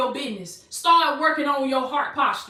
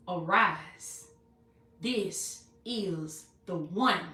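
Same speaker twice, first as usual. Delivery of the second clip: very slow, low and measured, word by word; hushed, deliberate proclamation; solemn divine decree; 7 semitones lower, 7 dB quieter, much slower than usual. Acted. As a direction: pronounce it as God's decree, soft and drawn out. Voicing the Lord's solemn decree.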